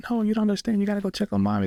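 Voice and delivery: put-on pleading high child's voice, a touch tearful; upset child. Crying son insists dad go check on mommy, high pleading voice. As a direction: insist like a crying little kid. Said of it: "hysterical, like, alligator tears, cries".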